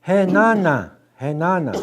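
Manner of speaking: Henana called high and loud, falling; Dakota enough. Shown as a demonstration. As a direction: say Henana firmly to mean that's all. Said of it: "That's all".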